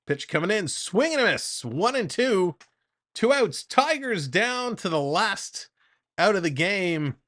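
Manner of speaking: lively baseball announcer delivery; play-by-play call. Acted. Streamer calling the Jays game for chat, announcer voice. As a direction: call the at-bat like a broadcast.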